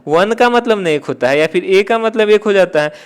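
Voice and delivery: usual speaking voice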